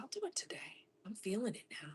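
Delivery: hushed, breathy whisper to herself; whispered self-pep talk. Retold or imitated. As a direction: whisper it like a secret resolve. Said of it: "was like".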